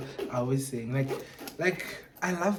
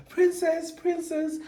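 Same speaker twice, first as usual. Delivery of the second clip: imitating coworkers; 5 dB louder, slower than usual. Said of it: "Even the guys at work".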